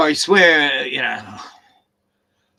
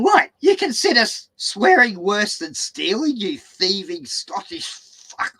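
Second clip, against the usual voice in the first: higher, slow, incredulous retort; retold outrage; 6 semitones higher, 5 dB louder, slower than usual. Cheated worker abusing builder on the phone, retold outrage. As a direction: act out an outraged comeback, drawing out the insult.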